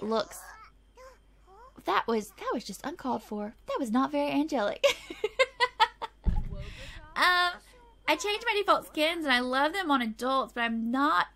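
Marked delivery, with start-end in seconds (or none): 4.9-7.1 high-pitched giggle fit; real, helpless high giggle; laughing. Real, not acted. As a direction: giggle away at your own joke. Laughs at her own joke.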